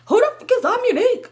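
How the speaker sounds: very high, indignant imitation; mock irate disbelief. Boyfriend questioning who the friend is, mimicked outrage. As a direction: fire back the question in squawking disbelief.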